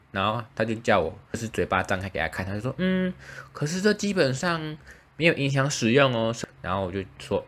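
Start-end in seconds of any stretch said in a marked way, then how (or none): 2.8-6.3 dentist voice. Retold or imitated. Patient retelling the dentist's reply, high dentist voice.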